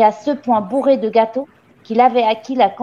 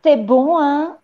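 higher, pointed question; needling headmistress; 5 semitones higher, slower than usual. A narrator's reading. Le Gourdin quizzing the chewing gamin, pointed and higher. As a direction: press the child for an answer.

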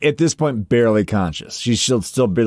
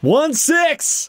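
much higher, rising cry; real exclamation. Real, not acted. Player repeats her dice roll to the table, exclaiming. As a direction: squeal the result, pitch leaping up.

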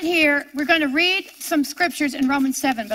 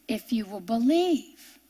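soft aside; 4 semitones lower, 5 dB quieter than usual. Real, not acted. Soft aside after pause.

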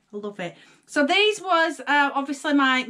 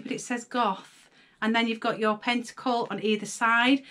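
ordinary talk

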